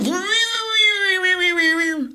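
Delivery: whining drill noise done with the mouth; mock drill noise. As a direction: imitate a power drill with your voice.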